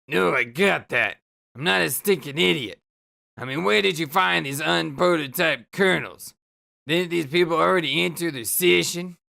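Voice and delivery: low, exasperated sarcastic rant; grumpy Karkat voice. Acted. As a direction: grumble it out like a fed-up teen troll. Karkat snapping back.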